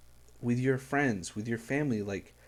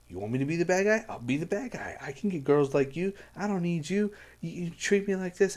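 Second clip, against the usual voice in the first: imitating; 6 semitones higher than usual. Retelling the song's argument.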